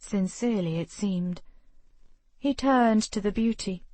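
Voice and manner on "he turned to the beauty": plain reading voice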